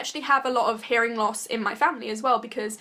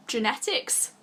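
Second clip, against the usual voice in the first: ordinary talk, nothing marked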